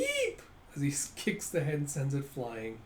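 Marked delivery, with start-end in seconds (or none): 0.0-0.4 long, high-pitched squeal; sudden shrill "eep"; high yelp. Acted. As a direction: squeal like you have just been kicked. Kicked servant's yelp.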